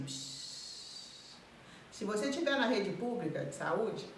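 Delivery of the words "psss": sharp shush to silence her; doctor's impatient shush. Retold or imitated. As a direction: hush the patient with a long psst. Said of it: "o médico faz assim".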